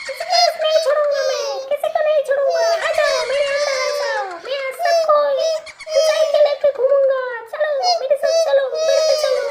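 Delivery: loud, gloating witch shouting through laughter; a witch triumphant, bellowing and cackling. Acted. Witch gloats, carrying children off.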